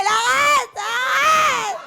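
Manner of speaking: high, crying plea to stop; pleading shout. Pretend. Woman begging her ex Melchior to stop kicking the bin, high crying shout. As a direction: beg him to stop, near tears.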